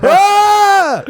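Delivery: high, loud mock scream. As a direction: let out a hammed-up shriek. Scream in retelling.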